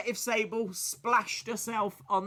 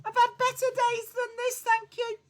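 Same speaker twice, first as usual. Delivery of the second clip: much higher, put-on whiny voice; mock-miserable falsetto; weary bar voice; 4 dB louder than usual. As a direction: sulk at the barman in a silly high voice. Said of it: "Why are you talking like that?".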